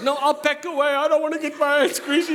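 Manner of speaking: put-on very high, pleading kid voice rising to a shout; whiny child. Preacher as a child pleading with his daddy, high and whiny. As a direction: beg like a little kid. Child begging father about dishes.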